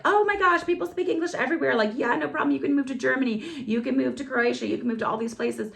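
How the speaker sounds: high, breezy mock-cheerful friend voice; gushing friends. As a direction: parody carefree married friends. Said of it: "married friends are like".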